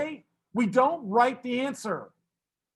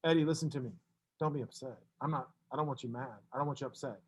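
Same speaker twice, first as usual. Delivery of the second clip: reassuring; 7 semitones lower, 10 dB quieter, much faster than usual. Real, not acted. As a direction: speak softly so he is not upset. Teacher reassuring an upset student.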